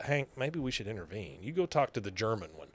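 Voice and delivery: soft, hushed aside to Hank; a loosely whispered Peggy suggesting they intervene; whispering. Retold or imitated. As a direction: keep it low so the roommates can't hear. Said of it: "Peggy, whispering".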